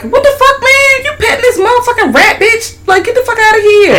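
squeaky outraged doll; high scolding character. Retold or imitated. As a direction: voice a shocked puppet telling off a friend. Voicing Psych scolding.

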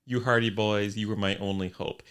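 ordinary talk, nothing marked

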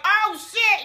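high, loud panicked exclamation; panicked cry. Retold or imitated. Man in the water seeing the shark, panicked high cry. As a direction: yelp it as the shark surfaces.

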